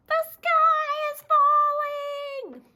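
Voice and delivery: very high, loud, slow bird cry; panicked cry. Acted. Goosey Lucy and Gosling Gilbert crying alarm, high slow wail. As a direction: wail the warning in terror.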